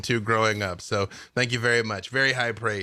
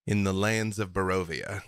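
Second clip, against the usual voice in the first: story narration; 3 dB quieter than usual. A narrator's reading. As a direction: narrator moves the scene in a radio drama.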